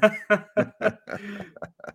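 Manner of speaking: high burst of laughter; big laugh. Real, not acted. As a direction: laugh out hard at the joke. Laughing at own golf joke.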